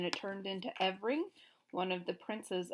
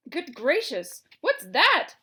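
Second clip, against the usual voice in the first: much higher, louder startled yell; 7 semitones higher, 12 dB louder, slower than usual. A narrator's reading. Gnome King startled by the hen, yelling. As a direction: jump and yell in alarm. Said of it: "he yelled".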